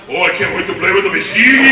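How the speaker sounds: giddy big gangster, loud and eager; Igor. Retold or imitated. Big gangster meeting his new playmate, giddy loud voice. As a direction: gush like a kid in a candy shop. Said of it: "looks like a kid in the candy shop".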